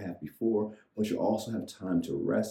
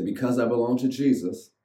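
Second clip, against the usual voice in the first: call to repeat; 5 semitones higher, 5 dB louder than usual. Shown as a demonstration.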